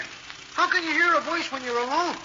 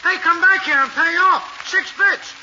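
louder, higher yelling after him; angry shout; 4 semitones higher, 9 dB louder than usual. Acted. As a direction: holler at a deadbeat running off. Driver yells after non-paying fare.

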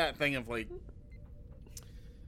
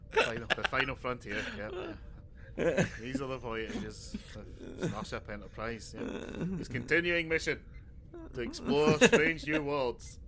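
slow, grand captain's address; hammed-up Picard opening speech; slower than usual. Mocking the captain's daily intercom speech, slow and grand. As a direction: stretch it out like a pompous broadcast.

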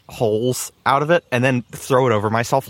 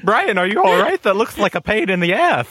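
higher, giggly teasing; cracking up; 5 semitones higher than usual. Real, not acted. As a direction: ask it while losing it to laughter. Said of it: "I'm cracking up".

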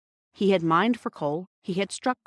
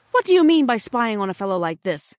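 indignant demand, raised and louder; angry; 4 semitones higher, 7 dB louder than usual. A narrator's reading. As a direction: confront them crossly. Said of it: "said Peter angrily".

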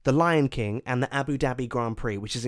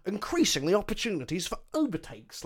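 commentator voice; 7 semitones higher, 3 dB louder than usual. Retold or imitated. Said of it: "they go".

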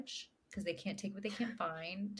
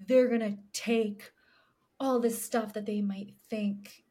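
lawyer voice; 3 semitones higher, 7 dB louder than usual. Retold or imitated. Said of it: "And he's like".

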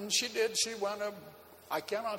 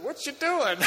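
amused; 9 semitones higher, 6 dB louder, slower than usual. Retold or imitated. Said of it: "I looked at her and I said".

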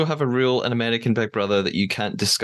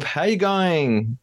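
higher, drawn-out mock Australian greeting; bad Australian accent; 6 semitones higher, slower than usual. Host greeting co-host, bad Australian accent. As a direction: stretch it out in a badly faked Australian accent. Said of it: "in a bad Australian accent".

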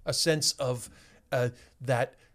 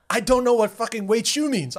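raised exasperated shout; frustrated yell; 7 semitones higher, 5 dB louder than usual. Retold or imitated. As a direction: snap back in frustration. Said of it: "And I said".

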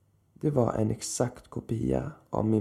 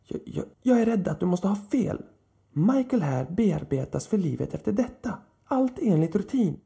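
much higher, flustered and louder; stammering old man; 10 semitones higher, 3 dB louder than usual. A narrator's reading. Startled old man stammers a reply to Lilith. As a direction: stumble nervously, clinging to procedure. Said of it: "stammade sitt svar".

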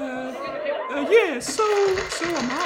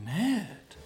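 much lower and softer than her voice; dropped low; 9 semitones lower, 8 dB quieter than usual. Real, not acted. Girl calling Ned, voice sinking low and soft. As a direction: let it sink down low. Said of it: "Oh, I got lower there".

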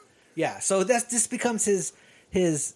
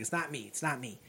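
It's not me murmured softer and lower; quiet pleading; 7 semitones lower, 13 dB quieter than usual. Retold or imitated. Student pleading with the principal, quiet and low. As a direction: sink into a soft plea on It's not me. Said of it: "Thomas Sam's like".

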